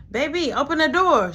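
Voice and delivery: high, loud call at the door; calling through door. Retold or imitated.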